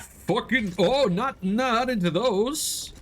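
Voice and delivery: high, slow panicked whine; panicked yelp. Real, not acted. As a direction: yelp in alarm as they close in. Sudden danger, yelping in panic.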